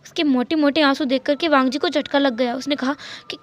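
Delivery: usual speaking voice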